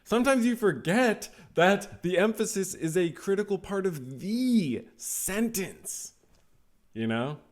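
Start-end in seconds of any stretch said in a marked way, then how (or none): 0.0-6.1 high-pitched, overdone stresses, "the" before "sentence" stretched to a long "thee"; over-emphasize "forget", "that" and a drawn-out "the" before "sentence"; exaggerated emphasis. Shown as a demonstration. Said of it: "like if I said".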